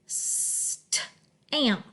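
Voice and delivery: "stamp" split into st, am, p; hissed st, then glued am, then a popped p; sounded out. Shown as a demonstration. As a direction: say "stamp" in separate chunks with pauses.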